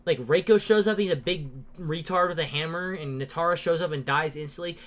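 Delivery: normal talking voice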